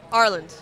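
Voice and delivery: Ireland said like "our land", run together; model pronunciation. Shown as a demonstration. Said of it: "It's like our land".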